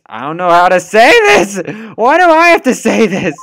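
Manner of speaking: loud, high panicked outburst; can't bring yourself to say it; panicked wail; flustered panic. Acted. Voicing flustered character.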